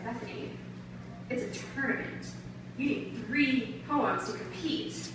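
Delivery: hushed retold teacher's correction; soft imitation of a crestfallen teacher; deflated teacher. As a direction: break bad news softly and slowly. Retold deflated teacher breaks news.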